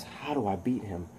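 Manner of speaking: hushed inner monologue of a hero; soft, pondering to himself; thinking aloud. Acted. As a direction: murmur it like a private thought. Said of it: "and he's thinking".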